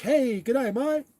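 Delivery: hammed-up Aussie g'day; mock Australian accent. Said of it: "everyone's like".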